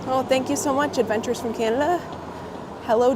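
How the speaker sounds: ordinary delivery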